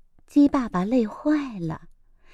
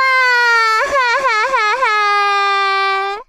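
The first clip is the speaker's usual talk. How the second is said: long high bawling cry; toddler wail; 10 semitones higher than usual. A narrator's reading. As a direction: howl like a tantruming child. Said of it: "鸡蛋妹妹哭了起来".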